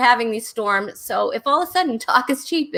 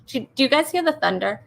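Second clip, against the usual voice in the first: normal talking voice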